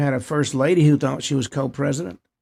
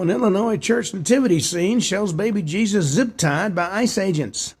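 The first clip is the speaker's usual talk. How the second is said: raised, punched-up headline delivery; news headline read; 5 semitones higher than usual. Real, not acted. Host opens a story on a church nativity scene, news headline read.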